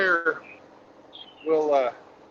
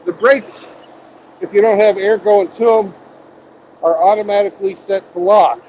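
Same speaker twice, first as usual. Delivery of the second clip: loud, raised voice over engine; shouting over machinery; 4 semitones higher, 12 dB louder than usual. Real, not acted. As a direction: call it out over the engine noise. Raised voice over truck noise.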